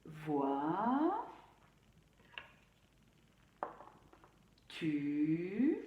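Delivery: voiture broken into slow syllables Vois, Tu; soft, separated syllables Vois … Tu; split into syllables. Shown as a demonstration. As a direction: say each syllable of voiture apart with a pause. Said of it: "On va bien découper le mot voiture".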